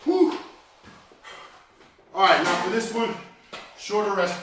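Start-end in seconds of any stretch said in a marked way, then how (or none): 0.0-0.4 loud breathy exhale after a set; relieved whew. Real, not acted. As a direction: blow out hard, spent.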